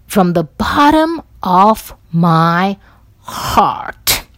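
heart with a rolled trilled R. Shown as a demonstration. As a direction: roll the R in heart. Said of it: "I roll the R in the heart".

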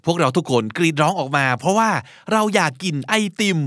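ordinary talk, nothing marked

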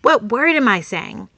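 ordinary delivery

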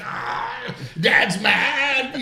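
high, loud mock cry of a little boy; imitating a scared son. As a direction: son squeals when dad raises his voice, high and loud. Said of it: "it's like".

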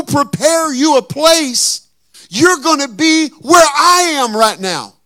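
loud, high, slow preaching shout; preaching at full force. Acted. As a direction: build it into a full preacher's cry.

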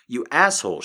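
sharp angry snap. Acted. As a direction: spit it out angrily. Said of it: "she says sharply".

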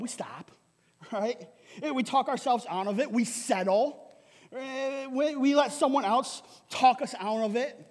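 normal talking voice

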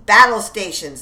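loud battle call; rally the men to their posts; alarm call. Acted. Officer alerting troops, loud call.

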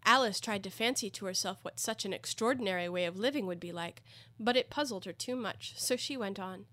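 plain reading voice

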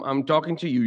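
ordinary talk, nothing marked

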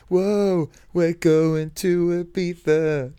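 high, slow party whoop. Retold or imitated. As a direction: whoop it up like a raver. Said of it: "He's going".

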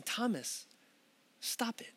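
hushed, breathy whisper of a gentle rebuke. Acted. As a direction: lean in and whisper it to Thomas. Voicing Jesus, intimate rebuke.

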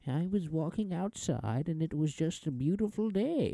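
put-on high, slow old-timey almost-British accent; elderly, proper British-ish lilt; old-person proper accent. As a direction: reminisce in a quaint, British-sounding old voice. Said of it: "there's just old person".

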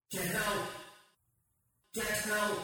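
faint, high cries from far away; very soft, high cry for help, as if fading into the distance; distant cries. A narrator's reading. Trapped man heard as the narrator fades away, distant cries. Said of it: "I heard the distant cries".

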